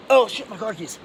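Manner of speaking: loud startled oh shit; sudden alarm. Real, not acted. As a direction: realize something in alarm. Suddenly remembers car keys.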